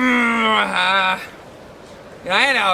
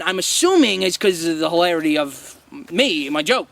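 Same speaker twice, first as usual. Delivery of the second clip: usual speaking voice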